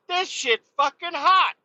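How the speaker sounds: high, lightly voiced complaint about hot coffee; imitating scalded driver. Driver covered in coffee complaining, high mock whine. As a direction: play the burned guy whining. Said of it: "He's like".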